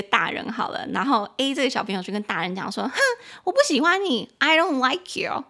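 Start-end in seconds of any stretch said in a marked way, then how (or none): 2.9-5.5 put-on high, pouting child; a child tells an adult he dislikes him, pouting; sulky child. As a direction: whine it like a sulky kid. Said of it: "A可能是个小朋友".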